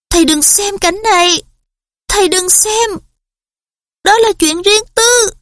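high, pleading inner voice; whispery telepathic voice. A narrator's reading. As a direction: cry out desperately inside the head. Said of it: "Một giọng nói vang lên trong đầu Harry".

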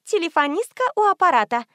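high-pitched operator greeting; telephone operator. A narrator's reading. Operator picking up the narrator's call, high-pitched greeting. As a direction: answer the line in a high voice.

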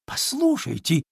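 high-pitched piglet call; calling after. A narrator's reading. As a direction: call out to stop someone leaving. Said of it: "закричал ей вслед Чуня". (кричит вслед, высоким голоском)

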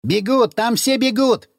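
a lookout's mock shout. A narrator's reading. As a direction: call out the alarm, excited. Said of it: "закричал Ходокири".

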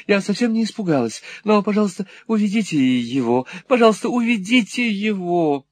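lightly voiced anxious daughter; Kate pleading. A narrator's reading. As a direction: beg them to take him away. Kate's urgent plea.

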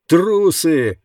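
sharp shouted insult. Acted. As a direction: yell it with contempt. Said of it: "крикнул кто-то".

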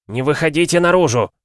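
high, loud warning call. A narrator's reading. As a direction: call out to a noisy crowd. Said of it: "повысив голос, обратился его отец". (повышенный голос, окрик)

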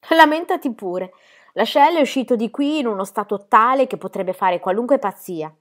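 acuta e forte, aspra; sbotto sarcastico. A narrator's reading. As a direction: rinfacciale il lamento. Alicia sbotta contro la sorella. (zia Alicia che aggredisce la sorella, forte)

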